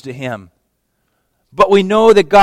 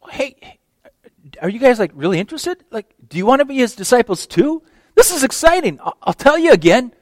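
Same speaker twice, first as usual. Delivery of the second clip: higher, hammed-up eager voice; mock excitement; 6 semitones higher than usual. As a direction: play it as a sarcastic, overeager salesman. Mock eager offer to the Pharisees.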